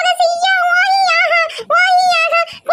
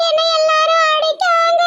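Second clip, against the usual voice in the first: usual speaking voice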